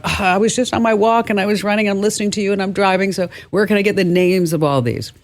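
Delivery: lightly acted plea from someone on the go; harried listener. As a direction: mimic a listener rattling off excuses. Voicing busy listeners on the go.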